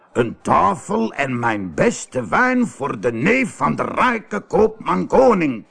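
slow, drawn-out call rising high; calling out orders. A narrator's reading. Innkeeper orders servants to serve the merchant's nephew, calling out. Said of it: "riep de herbergier tegen zijn bedienden".

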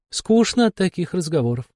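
high-pitched, weary woman boss; bored, dismissive female character, pitched high; bored demon curator. A narrator's reading. Bored curator scolds the agent.

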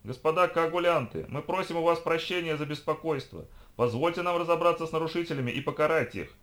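high-pitched official public address; high, projected announcer pleading; army loudspeaker appeal. A narrator's reading. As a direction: plead formally through a loudspeaker. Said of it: "распинался перед ними армейский усилитель".

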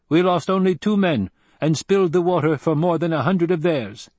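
high-pitched, satisfied warrior voice; Fremen desert fighter, proud. A narrator's reading.

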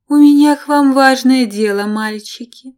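a coy temptress announcing business; seductive woman. Acted. Seductive ex arrives with news.

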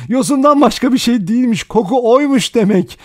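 high, loud, crazed relief; hysterical laughing. A narrator's reading. Vera finding it was only seaweed, hysterical amusement. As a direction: pitch it high, on the edge of hysteria. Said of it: "Delicesine gülmeye başladı".

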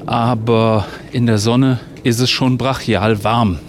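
ordinary talk

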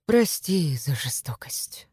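slow, gentle whisper; quiet and calm. A narrator's reading. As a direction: breathe it softly and calmly. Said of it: "звучал тихо и спокойно".

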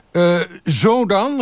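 high, slow questioning call. A narrator's reading. Half-hidden colleague asking the photographer, calling out. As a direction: call up from tiptoe at the back. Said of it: "riep hij, op zijn tenen".

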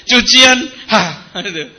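name the chore, then sigh heavily; mock complaining. Preacher mimicking a wife fed up with laundry, mock complaining. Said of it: "diselesaikan dengan ngeluh".

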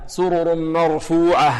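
slow مرفوعة ending in an audible breathed h; drawn-out recitation with clear closing h; clear final ha. Shown as a demonstration. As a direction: chant and release the h of مرفوعة distinctly. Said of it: "لابد من إيضاحها".

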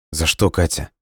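mildly puzzled question; a touch of gentle perplexity; bewildered. A narrator's reading. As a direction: ask it, not understanding at all. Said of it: "недоумевал парень".

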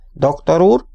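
crisp schoolmistress calling the doctor to attention; military briskness. A narrator's reading. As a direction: announce it like a soldier reporting. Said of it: "közölte katonásan".

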